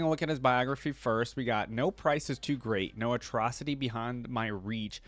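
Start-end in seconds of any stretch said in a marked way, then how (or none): none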